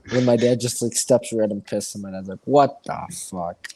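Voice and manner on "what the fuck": low, slow dad voice; disgusted dad. Retold or imitated. Dad stepping in dog piss, appalled. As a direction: imitate a dad in slow disbelief. Dad's retold disgusted reaction.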